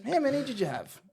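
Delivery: high-pitched mock interrogation; high comic coach voice; imitating the coach. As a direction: question a player in a high coach impression. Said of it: "He goes".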